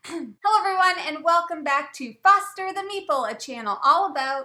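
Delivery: fast loud host intro; rapid excited announcer welcome; channel intro. Acted. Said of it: "Change the friggin' record".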